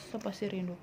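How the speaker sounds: usual speaking voice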